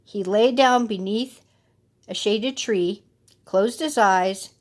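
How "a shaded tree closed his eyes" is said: usual speaking voice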